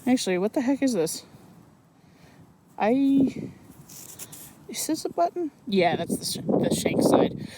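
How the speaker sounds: frozen face. Real, not acted. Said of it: "I can't talk very well because my face is frozen".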